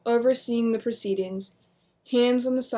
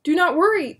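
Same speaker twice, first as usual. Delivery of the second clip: loud, high reassuring call; 8 semitones higher, 8 dB louder than usual. Acted. William reassuring his family, loud call. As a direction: call out to calm them. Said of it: "William started".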